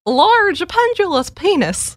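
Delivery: put-on high, slow little old lady voice. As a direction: say it like a prim old lady professor. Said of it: "hilarious little old lady voice".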